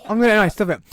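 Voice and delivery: ordinary talk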